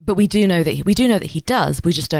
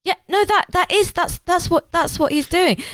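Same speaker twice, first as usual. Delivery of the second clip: high, flustered stammer; flustered Segestes. Retold or imitated. Segestes warning Varus, flustered and high. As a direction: stammer it out in a much higher voice. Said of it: "who's like".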